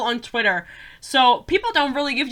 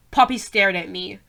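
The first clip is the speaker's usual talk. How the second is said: ordinary delivery, nothing marked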